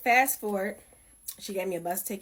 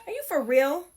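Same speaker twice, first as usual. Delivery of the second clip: incredulous; 6 semitones higher, 5 dB louder than usual. Retold or imitated. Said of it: "I remember looking at her like".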